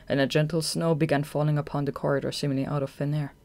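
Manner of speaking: plain reading voice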